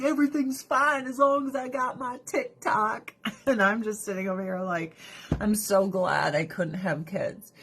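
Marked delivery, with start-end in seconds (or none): 0.0-3.1 higher, airy careless voice; mocking oblivious people. Mocking complacent people, higher carefree voice. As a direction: play a shallow, carefree scroller. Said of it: "And everyone's like".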